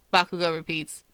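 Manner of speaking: ordinary delivery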